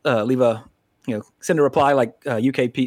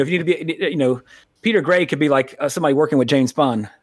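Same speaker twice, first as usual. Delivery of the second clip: ordinary talk, nothing marked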